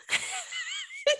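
high, soft giggling; helpless laughter. Real, not acted. Telling listeners the psychic got too personal, high giggling. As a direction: giggle helplessly at your own story. Laughs at her own story.

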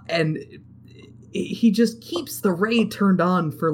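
ordinary talk

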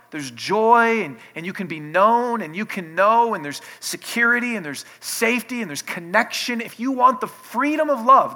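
loud, rising preacher's build on love; full-force preaching. Acted. Preacher urging the congregation toward love, loud and fervent. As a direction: pour it out to the congregation.

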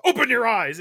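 mock angry snap; snapping. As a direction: bite the words out at him. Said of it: "[name] snaps on him quick".

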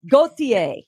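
Gauthier said "koh-tee-AY", French style, no L; French pronunciation. Shown as a demonstration. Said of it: "Get your French".

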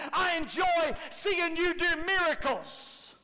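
high, loud preaching; preaching at full force. Acted. Preacher voicing Peter's answer. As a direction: drive the point home.

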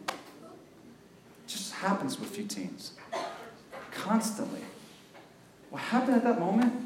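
hushed, weighty preaching; quiet, serious. Real, not acted. Preacher telling teens what happens to them, hushed and serious. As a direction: drop to a soft, grave voice with long pauses.

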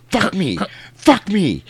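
high, loud demonic yell; a possessed girl yelling at her mother. Retold or imitated. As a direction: thrust it at her mom, shrieking.

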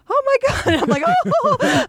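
very high, overcome retelling; high-pitched, emotional gasp of shock; stunned reaction. Retold or imitated. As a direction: reenact being floored by moving news. Reliving her shock at the story.

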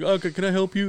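mock young clerk. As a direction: ask it like a nervous teenager. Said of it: "he's like".

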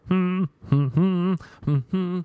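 high hummed tune; humming. Acted. Comedian recognizing the guy at the gym, humming. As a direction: hum it knowingly, building up. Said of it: "I started humming".

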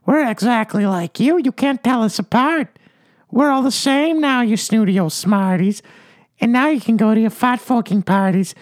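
very high, strident jeer; yelling taunt. Acted. New Veer-belly coiners jeering at the snooty ones, shrill-high. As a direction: taunt them in a squeal. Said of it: "they yelled at the ones".

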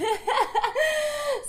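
high-pitched breathless giggle; breathless high laugh. Real, not acted. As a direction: laugh until winded. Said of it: "I'm out of breath".

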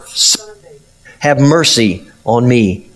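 ordinary talk, nothing marked

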